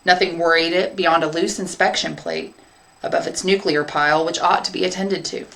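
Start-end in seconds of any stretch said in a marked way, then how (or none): none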